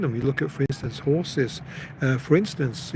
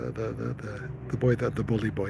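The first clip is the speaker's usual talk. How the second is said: ordinary delivery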